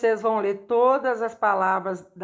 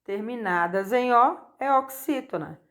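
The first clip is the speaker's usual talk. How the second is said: ordinary delivery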